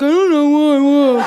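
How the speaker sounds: dopey mumbling husband imitation. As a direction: play a clueless husband, mouth half shut. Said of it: "same husband voice".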